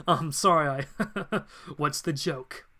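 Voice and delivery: acted villain chuckle through the words; mocking amusement, laughing between phrases. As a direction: break into chuckles while apologising. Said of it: "that's not why I'm laughing".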